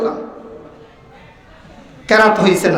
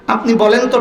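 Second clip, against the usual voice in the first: usual speaking voice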